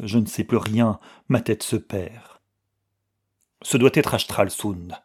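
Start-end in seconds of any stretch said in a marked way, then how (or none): none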